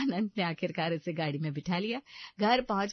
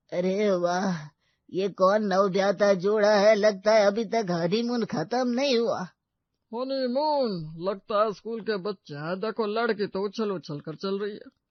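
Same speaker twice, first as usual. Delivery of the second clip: teasing onlookers; 6 dB louder than usual. Acted. Voices mocking onlookers.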